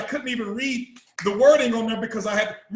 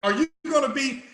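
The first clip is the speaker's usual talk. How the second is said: ordinary talk, nothing marked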